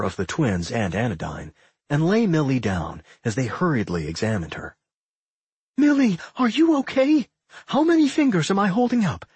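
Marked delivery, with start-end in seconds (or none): 5.8-9.2 high, anxious questioning; worried. A narrator's reading. Claire checks on the shaken Millie, worried. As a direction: check on a friend in high-pitched alarm.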